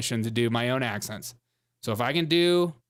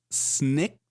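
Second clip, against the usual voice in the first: ordinary talk, nothing marked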